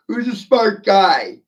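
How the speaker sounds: high, loud, slow sneer; mocking taunt. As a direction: tease them like a smug know-it-all. Mocking taunt after yell.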